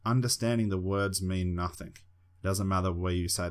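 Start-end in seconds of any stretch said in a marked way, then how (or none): none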